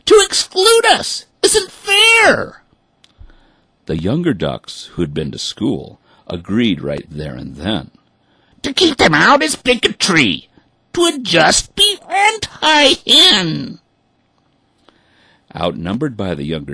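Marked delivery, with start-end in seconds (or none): 0.0-2.6 very high, loud, slow wailing hen voice; whining hens. A narrator's reading. Hens complaining to the ducks, wailing. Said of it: "the hens replied". 8.6-13.8 put-on young ducks. A narrator's reading. Young ducks defending the hens, high.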